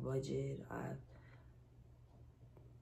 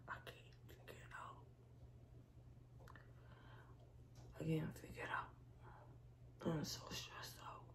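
sighed, barely voiced; stressed whisper; 9 dB quieter than usual. Real, not acted. Venting stress to viewers, a sighing whisper. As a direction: breathe it out, defeated.

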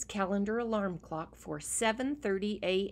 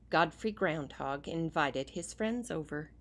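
usual speaking voice

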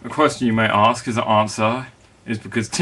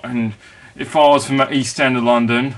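normal talking voice

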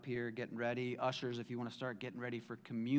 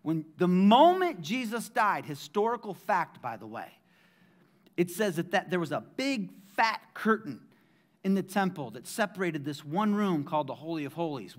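normal talking voice

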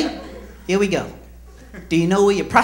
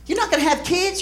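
incredulous; 9 semitones higher, 8 dB quieter than usual. Retold or imitated. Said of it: "people go".

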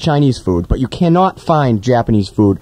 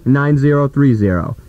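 usual speaking voice

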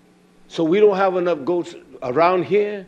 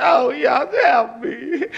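crying out to Yah; 9 semitones higher, much slower than usual. Retold or imitated. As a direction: cry out to Yah for help. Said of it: "fall at all and say".

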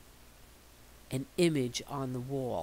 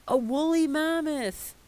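amazed reveal; 11 semitones higher, 6 dB louder than usual. A narrator's reading. Sudden sight of a mammoth.

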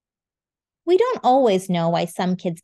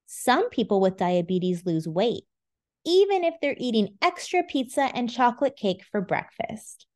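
plain reading voice

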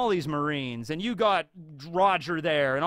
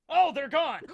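captain noticing desertion; 9 semitones higher than usual. Acted. Rox notices his crew left.

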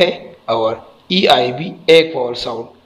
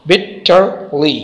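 very slow "bitterly" with gaps, bit, ter, ly; syllable by syllable; 3 semitones higher, much slower than usual. Shown as a demonstration. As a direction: clear pause after each syllable of "bitterly". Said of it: "इसमें तीन syllable है".

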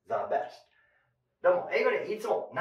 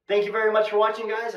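cheery sign-off; 6 semitones higher, 6 dB louder, faster than usual. Real, not acted.

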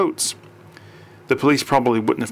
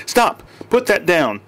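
urgent shout; 7 semitones higher, 4 dB louder, slower than usual. A narrator's reading. Stopping chief with scalpel.

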